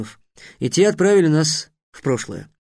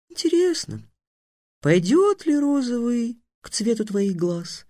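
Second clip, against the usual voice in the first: musing; 8 semitones higher than usual. A narrator's reading. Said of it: "задумчиво сказала Тиана".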